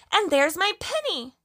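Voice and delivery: put-on high, delighted little-girl exclamation; excited cry. A narrator's reading. Lucy spots her lost pinny, excited high cry. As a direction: pipe up high on the discovery. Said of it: "cried Lucy".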